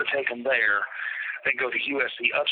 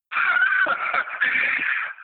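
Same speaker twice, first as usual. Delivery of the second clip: a burst of incredulous laughter; sharp laugh. Real, not acted. Biker laughing at the absurd threat. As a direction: laugh out in disbelief. Laugh after retold taunt.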